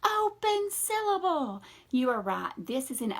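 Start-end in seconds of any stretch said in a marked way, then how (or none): 0.0-1.6 high, slow mock kids' chorus; voicing the kids at home; mock kids calling out. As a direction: call it out like an eager class. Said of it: "you at home are probably screaming a word at me".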